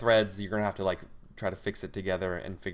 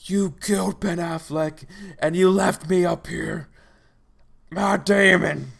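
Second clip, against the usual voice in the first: high, loud, slow snarling monster voice; wounded Nemesis monster; 9 semitones higher, 9 dB louder, slower than usual. Acted. Wounded Nemesis accusing the hero, slow monstrous roar. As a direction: drag each word out like a hurt beast. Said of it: "and he's like".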